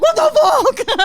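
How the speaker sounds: high, loud laughing disbelief; shocked laugh. Retold or imitated. Storyteller shocked that her date moved on, high laughing outburst.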